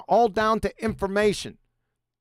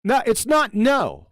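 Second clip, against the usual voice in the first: louder, higher, exasperated rebuke; real annoyance, pitched up and loud; irritated scolding; 4 semitones higher, 4 dB louder than usual. Real, not acted. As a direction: tell an interrupter off, raised voice. Scolds third person for interrupting.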